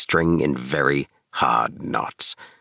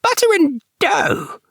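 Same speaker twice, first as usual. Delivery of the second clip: very high, louder squeaking rat woman; Anna Maria; 4 dB louder, slower than usual. Acted. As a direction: snap back shrilly at her husband. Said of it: "replied Anna Maria".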